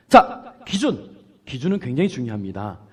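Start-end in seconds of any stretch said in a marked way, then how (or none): 0.1-1.2 기준 slow, loud and high; slowly proclaimed 기준; stress on 기준. Shown as a demonstration. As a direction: hit the word 기준 hard. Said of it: "stressing the key word".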